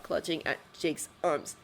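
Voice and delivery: plain reading voice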